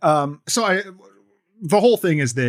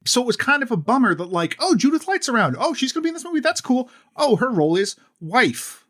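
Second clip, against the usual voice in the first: mock excitement; 12 semitones higher, 3 dB louder than usual. Mocking her own hopeful reaction.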